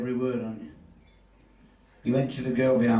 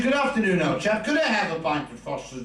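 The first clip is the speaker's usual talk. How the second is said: posh city gent; 6 semitones higher than usual. Retold or imitated. Posh city gentleman ordering beer.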